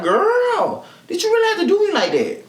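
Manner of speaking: high, fast disbelieving plea; exasperated plea. Retold or imitated. Speaker asking the girl who beat her, high and incredulous. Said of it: "it's like".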